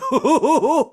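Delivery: high, loud cartoon chortle; goofy hooting laugh; Goofy chuckle. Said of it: "Goofy would meet you at the gate".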